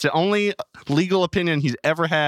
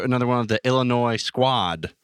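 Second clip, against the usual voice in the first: normal talking voice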